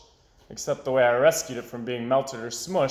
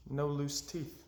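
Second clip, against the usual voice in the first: much softer, deflated; disappointed; 11 dB quieter, slower than usual. Acted. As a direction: let the hope drain out. Failed plan to get money.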